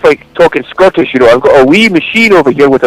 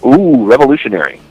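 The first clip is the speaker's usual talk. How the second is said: usual speaking voice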